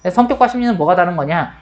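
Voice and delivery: ordinary talk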